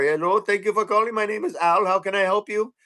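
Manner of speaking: mock Indian call-center greeting; Indian accent. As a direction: imitate a support agent with an Indian accent. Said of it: "their call centers are all in India now".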